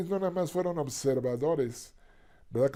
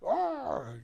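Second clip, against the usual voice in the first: mock frightened cry; 12 semitones higher than usual.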